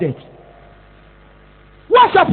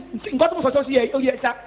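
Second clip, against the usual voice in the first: usual speaking voice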